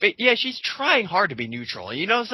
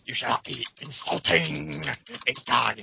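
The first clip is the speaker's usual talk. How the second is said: lower, slow, sneering mock scold; lightly voiced preachy follower, pitched lower; 4 semitones lower than usual. Mocking Vicious's followers scolding a critic, lower mock sneer.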